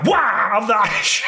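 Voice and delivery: short triumphant yelp; mock attack cry. Cat owner ambushing the cat with a water spray, mock attack cry. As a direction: pounce with a playful battle cry.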